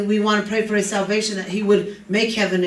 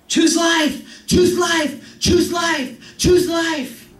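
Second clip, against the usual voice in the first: shouted much higher, pleading choose life; urgent shout; 6 semitones higher, much slower than usual. Real, not acted. Preacher urging the congregation to choose life, shouting. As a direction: cry it out to the congregation.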